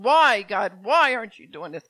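loud retold outcry to God; a loud imitated wail of why; crying out why. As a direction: shout the why at heaven. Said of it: "Jimmy was crying out".

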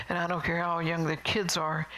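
ordinary talk, nothing marked

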